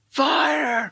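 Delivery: high-pitched mock alarm call; imitated yell. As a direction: call out like someone raising the alarm. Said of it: "I hear Will yelling out".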